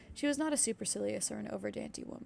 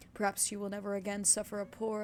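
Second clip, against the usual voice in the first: plain reading voice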